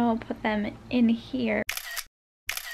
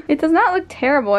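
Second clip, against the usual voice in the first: louder, higher, laughing denial; laughing protest; 6 semitones higher, 6 dB louder, faster than usual. Real, not acted. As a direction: laugh it off indignantly. Protests brother's insult, laughing.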